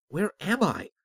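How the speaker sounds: high-pitched, urgent question; bewildered and insistent; agitated demand. A narrator's reading. Confused man questions the unseen voice.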